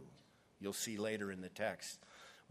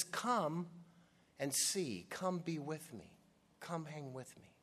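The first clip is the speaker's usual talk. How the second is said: higher, fading softer to the end; Jesus to us, tender and fading; tender invitation; 4 semitones higher, 3 dB quieter than usual. Acted. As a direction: draw them in gently, fading out.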